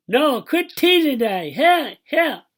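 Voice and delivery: high, loud, drawn-out whine; high distressed holler; wailing protest. A narrator's reading. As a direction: wail at Daddy with lips stuck. Stuck boy protesting teasing, acted.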